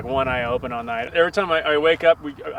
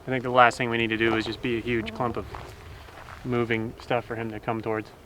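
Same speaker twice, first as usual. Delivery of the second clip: ordinary talk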